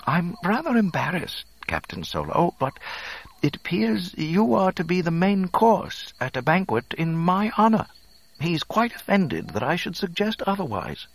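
very high, chagrined droid; Three P O. A narrator's reading. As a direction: break bad news in a prissy, high robot voice. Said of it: "Three P O wilted with chagrin".

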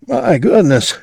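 high startled exclamation. A narrator's reading. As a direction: jolt awake in surprise. Dog startled awake.

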